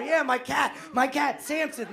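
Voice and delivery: ordinary talk, nothing marked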